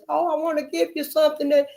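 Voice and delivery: imitating the man who offered the books; the man offering books. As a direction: play the helpful stranger lightly. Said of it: "that man gave me them books".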